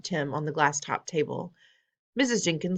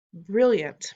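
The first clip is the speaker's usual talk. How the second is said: louder, higher delighted cry; cried out; 4 semitones higher, 5 dB louder than usual. Acted. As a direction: burst out with delight. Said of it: "my grandmother cried out".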